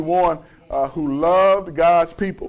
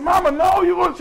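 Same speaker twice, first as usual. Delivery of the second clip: imitated son, pitched way up; high protest; 11 semitones higher than usual. Son argues with his challenging mother, high protest. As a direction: object in a high voice to Mama.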